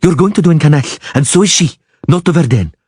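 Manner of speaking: threat. A narrator's reading. Dryad threatens.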